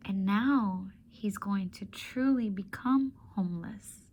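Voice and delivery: ordinary delivery, nothing marked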